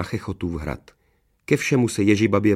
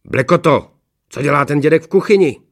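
loud, high-pitched demand; harsh master calling his servant to account; stern questioning; 7 semitones higher, 8 dB louder than usual. A narrator's reading. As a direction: shout it sternly into the microphone. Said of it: "přísně se zeptal".

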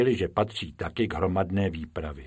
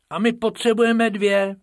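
high, loud little-character voice; high-pitched cartoon rabbit; Bobek character voice; 12 semitones higher, 5 dB louder than usual. A narrator's reading. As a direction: pipe up high as the small rabbit.

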